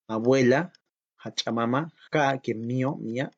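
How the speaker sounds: usual speaking voice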